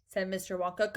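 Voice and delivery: usual speaking voice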